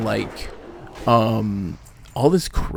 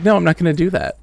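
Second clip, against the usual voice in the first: louder, higher, standing firm; refusing the customer's bait, firm and raised; firm refusal; 6 semitones higher, 6 dB louder, faster than usual. Retold or imitated. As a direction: turn down the bait out loud.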